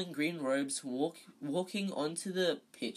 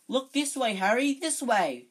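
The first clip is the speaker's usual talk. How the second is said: high, loud, eager cry; shrill cry; 8 semitones higher, 9 dB louder than usual. Acted. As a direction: yell it up at him, begging for a photo. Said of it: "he cried shrilly".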